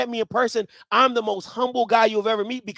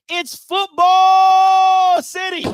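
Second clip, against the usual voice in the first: loud slow announcement; 7 semitones higher, 8 dB louder, much slower than usual. Real, not acted. Host hyping football after this, loud slow call. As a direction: stretch it out big and loud, higher. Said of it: "I'ma tell you right now".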